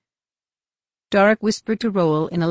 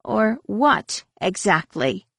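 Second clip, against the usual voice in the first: drawn-out, higher opening question; defiant challenge; 5 semitones higher, much slower than usual. A narrator's reading. Mara standing up to Roel, defiant challenge. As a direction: dare the other to finish the threat.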